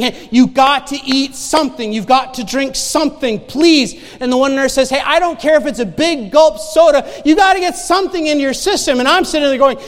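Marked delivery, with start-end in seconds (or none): 0.0-4.0 higher, louder insistent urging; emphatic nurse's appeal; urgent pleading. Retold or imitated. Nurses urging a withering patient. 4.9-9.0 loud, high-pitched exhortation; forceful, exasperated nurse's plea; insistent urging. Retold or imitated. Nurse urging patient to take something.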